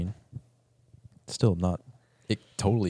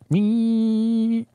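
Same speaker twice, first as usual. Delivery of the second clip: high squeaky robot cry; robot wee; 14 semitones higher, 4 dB louder than usual. Retold or imitated. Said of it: "and it just goes".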